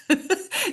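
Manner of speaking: short, high-pitched laugh; little laugh after admitting failure; self-mocking laugh. Real, not acted. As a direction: laugh at your own slip. Admits she skipped her workout.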